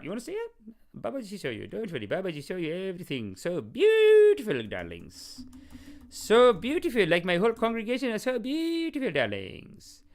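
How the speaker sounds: soft, high, cooing guru voice; guru character. Acted. Guru showing his followers the ashram, soft cooing. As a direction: coo like a sweet mystic guru.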